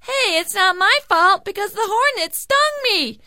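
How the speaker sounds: very high, loud put-on voice; protesting pig. A narrator's reading. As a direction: squeal out your defence like a pig. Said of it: "The pig said".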